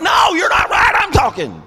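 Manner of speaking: hammed-up shout over the family; high, loud yell to win the floor. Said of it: "I was like".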